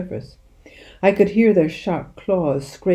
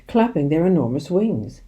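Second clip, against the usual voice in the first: ordinary delivery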